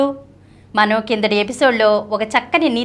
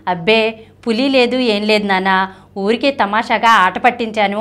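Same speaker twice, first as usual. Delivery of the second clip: usual speaking voice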